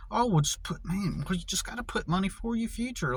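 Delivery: high, airy mock of the careless advice; mocking casual voice. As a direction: toss it off lightly, high and fast. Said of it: "casually just say".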